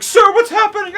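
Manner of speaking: mock pleading. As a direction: beg the boatman for answers. Tourists pleading with motor operator.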